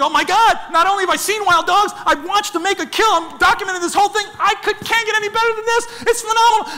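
thrilled inner voice, pitched high; high, breathless-with-wonder storytelling; excited retelling. Retold or imitated. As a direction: gush in amazement as you relive it. Retelling his thrill at the kill.

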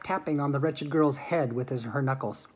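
usual speaking voice

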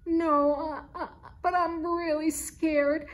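a high, scared kitten plea; crying. A narrator's reading. Scared cold kitten begging Charlie from the drain, frightened plea. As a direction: beg to be pulled out, frightened. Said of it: "Meeser cried".

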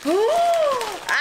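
high gasping squeal; excited gasp. Real, not acted. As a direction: gasp with delight.

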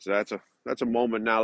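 usual speaking voice

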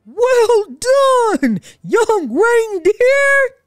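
slow, loud, high cheerful shout. A narrator's reading. Santa praising the young reindeer, cheerful shout. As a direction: praise ringing out. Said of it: "he shouted cheerfully".

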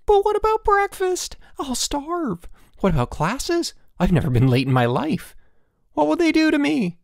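high-pitched frantic worrying; panicked. A narrator's reading. Elmer late for classes to his roommate, panicked. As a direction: fret in a high, alarmed voice.

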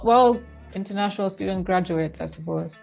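ordinary talk, nothing marked

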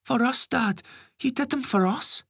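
put-on high young boy, protesting. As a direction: defend his dad like a kid.

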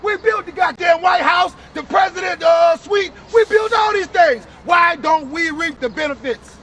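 forceful, emphatic preaching; street preaching. Real, not acted. Preacher to brother about Israel's promises, forceful preaching.

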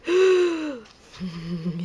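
slow breathy shocked aside; gasp, then say it in awe. A narrator's reading. Shocked skunk face.

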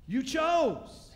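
loud, high booming judgment; stern God. Acted. God judging Adam and Eve, loud stern pronouncement. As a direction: thunder down the verdict.

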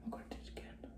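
unvoiced whisper to herself. Real, not acted. Vlogger muttering to herself after poking her eye, whispered. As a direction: whisper it under your breath.

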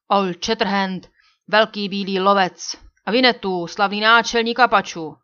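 loud, measured cry naming each hero; exclaimed recognition. A narrator's reading. Chief suddenly recognises the two famous men, exclaiming loudly. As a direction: proclaim the famous names loudly, building. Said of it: "vykřikl náčelník".

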